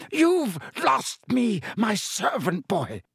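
lunge with the words; furious roar. Acted. Malfoy lunges at Harry.

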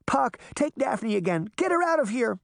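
high, urgent command; frantic high-voiced order; urgent plea. A narrator's reading. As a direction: cry out for help, pitch climbing. Urgent order while fleeing giant.

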